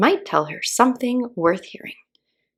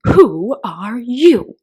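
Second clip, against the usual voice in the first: slow, drawn-out, louder caterpillar; 5 semitones higher, 8 dB louder, much slower than usual. A narrator's reading. Caterpillar questioning Alice, slow haughty drawl. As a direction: stretch each word lazily and haughtily.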